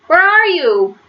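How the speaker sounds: a high, searching call; worried calling. Acted. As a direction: call out into the sky, looking for him. Bill searching for lost George.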